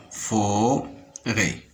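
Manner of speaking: FO-RÊT with a pause in the middle; Forêt broken into two syllables; split into syllables. Shown as a demonstration. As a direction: sound out Forêt in two beats. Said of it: "dans la première syllabe".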